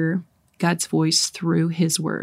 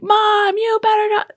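put-on much higher, childish warning; high kids' voice; slower than usual. Siblings warning their mom, high childish voice. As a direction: squeak it like a worried child.

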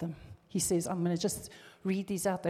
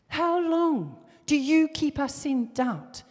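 demanding crowd; 8 semitones higher, 8 dB louder, slower than usual. Retold or imitated. Preacher voices the crowd's demand.

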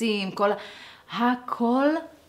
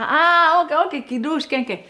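startled; 8 semitones higher, 5 dB louder than usual. Retold or imitated. Husband caught out, corrects himself.

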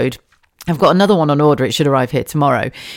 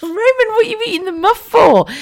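much higher, slow, sing-song telling off; mock scolding mum; 3 dB louder than usual. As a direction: tease your toddler in a high mum voice. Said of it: "And then literally just went".

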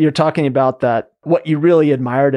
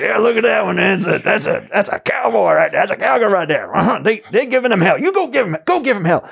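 much higher hammed-up cowboy cheering on a fight; Rooster Cogburn voice; 9 semitones higher than usual. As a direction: egg them on like an old Western cowboy. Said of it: "Rooster Cockburn, something like with that gravelly voice".

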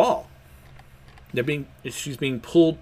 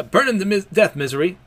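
commanding shout; 7 semitones higher, 5 dB louder than usual. Acted. Big Mom orders attack.

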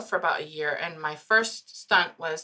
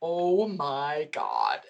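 awestruck; 5 semitones lower, much slower than usual. Retold or imitated. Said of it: "going, like".

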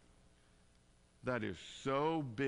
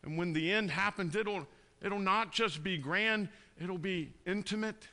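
louder and higher, building to full preaching force; rising sermon climax, pleading and loud; impassioned preaching; 8 semitones higher, 5 dB louder than usual. Acted. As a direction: preach it out to the congregation with fire.

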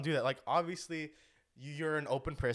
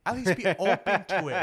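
laughing; 7 semitones higher, 10 dB louder than usual. Real, not acted. Laughing jab at country haters.

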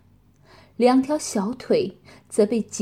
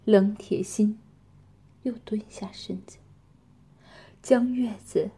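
plain reading voice

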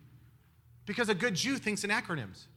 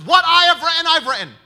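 defiant Pilate; 8 semitones higher, 14 dB louder than usual. Retold or imitated. Pilate defiant about the plaque.

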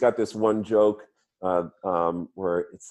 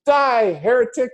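mock outraged cry; 12 semitones higher, 6 dB louder than usual. Punchline shove off the bridge.

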